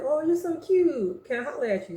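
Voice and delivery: high sweet-talking come-on; flirty guy. Retold or imitated. Guy hitting on her, high flirty voice.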